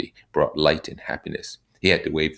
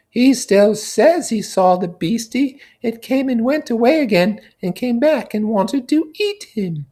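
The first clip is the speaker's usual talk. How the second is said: put-on high, childlike voice, much higher and louder; piping kid relaying a small boy's fear; little boy's go-between; 8 dB louder than usual. Piggy passing on the little boy's question in a high childlike voice.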